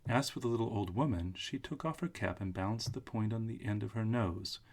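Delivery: plain reading voice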